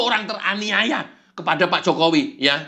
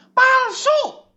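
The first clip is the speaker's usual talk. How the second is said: far higher squeal, pushed out; shrieking high devil; 3 dB louder than usual. Acted. Devil squeals that it's all fake. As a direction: squeal the word like an imp.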